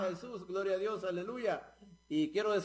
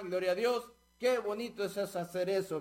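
usual speaking voice

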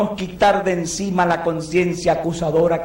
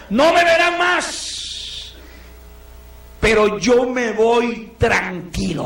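Paul's slow farewell; 3 semitones higher, much slower than usual. Retold or imitated. Paul bidding the church farewell, very slow. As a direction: linger on every word of goodbye. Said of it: "Oiga usted cómo dice Pablo".

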